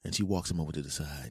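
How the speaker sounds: usual speaking voice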